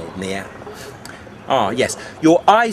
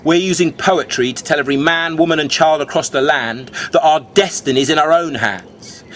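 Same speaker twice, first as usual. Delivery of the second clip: usual speaking voice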